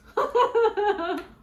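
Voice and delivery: bursting out laughing, high and loud; a big real laugh. Real, not acted. Laughs at her own joke.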